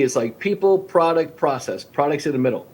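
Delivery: usual speaking voice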